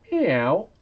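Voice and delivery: playful high meow; cat meow. Pretend. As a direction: meow like a kitten. Cat meow during stream.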